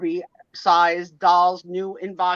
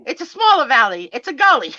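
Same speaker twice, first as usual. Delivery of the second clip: put-on Southern accent; 8 semitones higher, 10 dB louder than usual. Friend explains a gully, mock Southern accent. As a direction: ham up a country Southern lady explaining. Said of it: "She's like".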